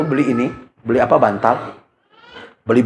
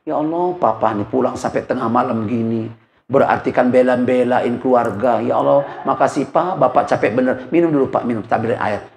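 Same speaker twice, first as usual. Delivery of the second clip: tender wife; 6 semitones lower than usual. Retold or imitated. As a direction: welcome a tired husband gently. Ideal wife greeting tired husband.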